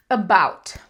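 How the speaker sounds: ABOUT with a light schwa, strong BOUT; aBOUT, weight on the second syllable; stress on BOUT. Shown as a demonstration. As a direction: reduce the first vowel of "about" to uh. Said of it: "a minha ênfase é no about".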